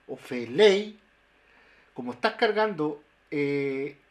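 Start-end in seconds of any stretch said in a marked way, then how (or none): 0.2-1.0 "felei" louder, the final E strong before a glided I; stress on E. Shown as a demonstration. Linguist giving a second example, stress on E. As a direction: lean on the last E of "felei". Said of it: "el acento en la E".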